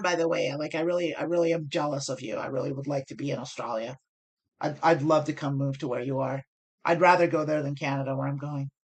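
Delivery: ordinary delivery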